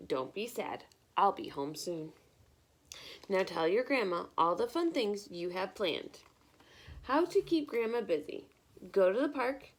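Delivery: lively picture-book read-aloud; bright, expressive storytime reading; reading to children. Acted. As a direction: read it like storytime for little ones.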